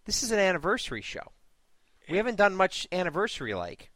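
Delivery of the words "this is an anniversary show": usual speaking voice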